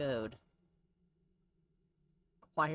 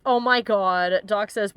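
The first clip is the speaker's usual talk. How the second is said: normal talking voice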